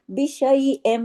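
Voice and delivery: बिशैइम chanted with a held nasal म; recited word. Shown as a demonstration. Teacher reciting for students, nasal hold. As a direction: hum the doubled म of बिशैइम. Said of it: "मीम पे तशदीद है, गुन्ना हुआ".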